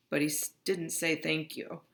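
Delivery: ordinary delivery, nothing marked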